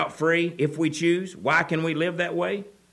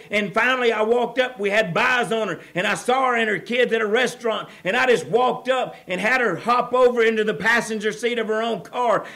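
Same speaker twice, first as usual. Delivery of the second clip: raised, loud preaching voice, driving hard; fiery preaching; 7 semitones higher, 5 dB louder than usual. Acted. Preacher rousing the congregation about addiction, loud and fervent. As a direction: preach it out to the whole church. Preacher builds to full force.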